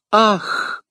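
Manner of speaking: high-pitched reproachful exclamation; с упрёком. A narrator's reading. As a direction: cry out in reproach. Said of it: "воскликнула с упрёком". (высокий возглас упрёка)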